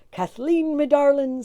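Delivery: loud, fond, happy call; loving. A narrator's reading. Tim fondly addressing his wife Kathleen. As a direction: call to her with delight. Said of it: "said Tim".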